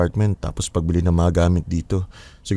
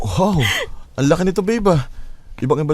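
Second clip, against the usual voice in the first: amazed; 9 semitones higher than usual. Acted. Amazed at the big apartment.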